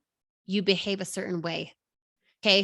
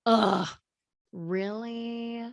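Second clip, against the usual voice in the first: an exasperated groan, then a dropped, breathy really; tired mother fed up with the dishes; exasperated ugh; 2 semitones higher, much slower than usual. Retold or imitated. Mother voicing her own thought at husband, exasperated and fed up.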